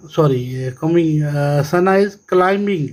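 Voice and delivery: ordinary talk, nothing marked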